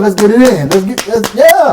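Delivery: high, loud coach-style rallying; hype-up call. Retold or imitated. Trainer rallying trainees to get going, hyped. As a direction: pump up the team.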